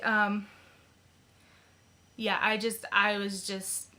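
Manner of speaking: normal talking voice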